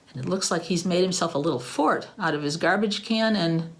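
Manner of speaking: plain reading voice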